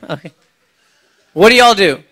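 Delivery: ordinary delivery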